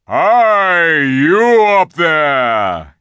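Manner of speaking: huge, drawn-out hail; bawling. A narrator's reading. Aslan hails the giant high above him, bawling. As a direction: bellow it slowly to someone high above. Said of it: "he bawled up at the giant".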